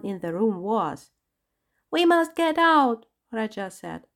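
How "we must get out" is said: high, loud panicked whine; hysterical whine. A narrator's reading. Raja begging the others to flee, a hysterical whine. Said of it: "his voice rising to a hysterical whine".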